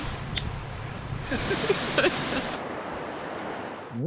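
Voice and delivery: soft airy laugh after the joke; breathy giggle. Real, not acted. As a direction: let out a quiet giggle. Laugh after silly intro.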